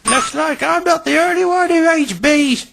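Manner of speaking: amused, very high character voice; high-pitched jovial ribbing; James. A narrator's reading. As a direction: tease in a high, chuckly engine voice. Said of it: "chuckled James".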